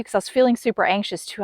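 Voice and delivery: ordinary delivery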